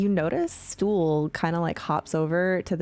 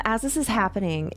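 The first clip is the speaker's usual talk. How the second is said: normal talking voice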